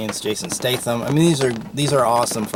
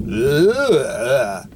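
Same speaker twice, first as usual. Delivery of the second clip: long drawn-out mock groan, rising then falling; wordless swooping grunt of effort; Stallone; 3 dB louder than usual. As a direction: groan "ugh" in a hammed-up action-hero impression. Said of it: "That's my Stallone impression".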